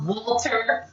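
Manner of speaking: ordinary delivery, nothing marked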